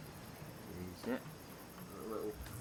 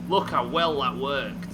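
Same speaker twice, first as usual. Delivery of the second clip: usual speaking voice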